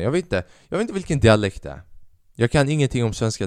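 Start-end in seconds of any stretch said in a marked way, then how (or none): none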